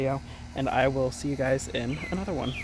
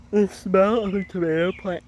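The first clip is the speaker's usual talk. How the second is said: talking through a yawn; 6 semitones higher, 7 dB louder than usual. Real, not acted. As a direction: yawn while saying it. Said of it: "I'm sorry for yawning".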